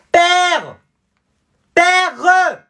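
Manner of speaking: very high, loud summons; spoiled yell. Acted. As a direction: shout for daddy like a brat. Spoiled son summons father.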